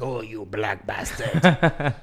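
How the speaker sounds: put-on very slow, drawn-out grumble of an old man. Host imitating an old neighbour yelling over a football, slow grumble. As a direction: act the cranky old man on the block, dragging it out. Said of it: "to be like".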